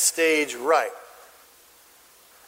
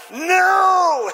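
high, loud, drawn-out shout; passionate yell; 12 semitones higher, 6 dB louder than usual. Acted. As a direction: leap up and yell like outraged kids. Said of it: "great passion and yell".

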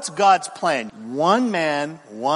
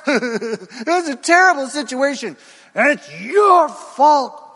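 higher, louder sulky outburst; petulant Cain; 6 semitones higher, 5 dB louder than usual. Acted. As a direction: storm off like a sulking teenager blaming everyone. Preacher voices Cain storming off.

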